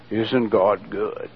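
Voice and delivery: slow, low, softly intoned preacher; half-hearted preacher. Retold or imitated. Bored pastor telling the congregation God is good, half-hearted. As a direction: drone it out slowly, low and uninterested. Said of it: "they had different voices".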